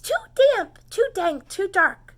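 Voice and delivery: high, loud squeaky mouse voice. Acted. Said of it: "Mouse squeaks".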